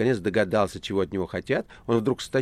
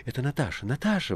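higher, startled recognition; exclaiming; 6 semitones higher than usual. A narrator's reading. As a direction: exclaim the name, pitch jumping up. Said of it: "воскликнула Маргарита".

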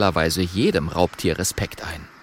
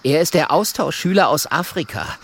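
high, small dragon voice; high-pitched young dragon; little dragon; 6 semitones higher than usual. Acted.

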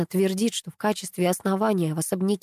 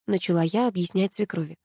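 plain reading voice

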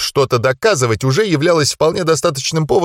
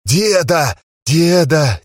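rising panicked calls; 5 semitones higher, much slower than usual. Acted. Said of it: "трясся и повторял".

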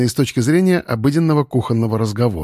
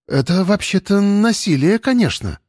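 drawled; 7 semitones higher than usual. Acted. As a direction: stretch out конечно lazily. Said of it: "протянула Вася".

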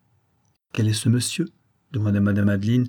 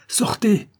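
much higher, shouted order; angry shout; 12 semitones higher than usual. Acted. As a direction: lose patience and shout. Adeline orders the visitor out.

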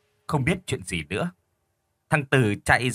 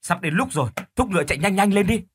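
raised urgent call; urging on; 7 semitones higher than usual. Acted. Husband urging his wife to ride faster, raised urgent call. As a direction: hurry the horses along, voice lifted.

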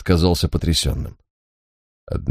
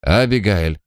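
ordinary delivery, nothing marked

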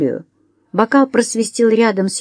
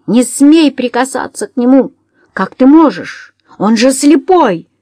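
higher, louder desperate shout; отчаянный крик; 5 semitones higher, 5 dB louder than usual. A narrator's reading. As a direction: scream to protect him. Джулия кричит, защищая Уэсли. (громко, высоко, в отчаянии)

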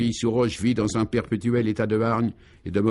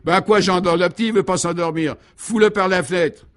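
high-pitched exasperated yelling; beside himself; 8 semitones higher, 5 dB louder than usual. A narrator's reading. Father interrupting his bawling wife, beside himself. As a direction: explode at the racket. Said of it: "le père hors de lui intervient".

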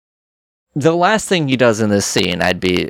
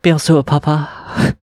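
slow French reply; 2 semitones higher, slower than usual. Retold or imitated. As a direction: answer sweetly in French. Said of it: "asked Freddie if he still knows French".

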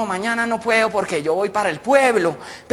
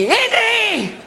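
calling parrot; 14 semitones higher than usual. Acted. Parrot calls to the crucified Christ, screeching. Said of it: "Y empezó el loro".

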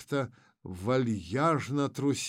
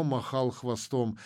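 ordinary delivery, nothing marked

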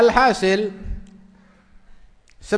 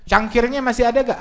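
higher-pitched, quick-to-know question; eager questioning; 6 semitones higher, 4 dB louder, faster than usual. Acted. Husband asking wife about the cup, eager. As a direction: ask eagerly, voice lifted.

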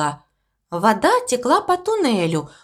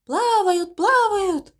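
echo; 10 semitones higher, slower than usual. A narrator's reading. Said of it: "подхватило эхо".

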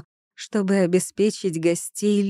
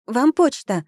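calling out loudly, higher; shouted; 6 semitones higher, 3 dB louder than usual. A narrator's reading. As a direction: call it out. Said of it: "крикнула я".

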